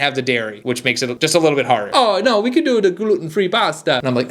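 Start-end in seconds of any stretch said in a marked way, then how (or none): 1.9-4.0 high-pitched mock Italian accent; badly faked Italian accent, pitched up. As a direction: put on a hammy Italian accent. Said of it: "could do a better Italian accent".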